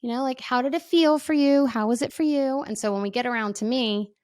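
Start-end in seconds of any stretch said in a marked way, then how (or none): 0.0-2.6 high, bright leader voice; rally the ladies to share; cheery group leader. Retold or imitated. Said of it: "our leader was like".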